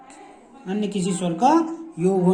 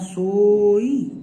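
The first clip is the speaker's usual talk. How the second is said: slow demo; 5 dB louder, much slower than usual. Shown as a demonstration. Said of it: "तो सोई में देखिए".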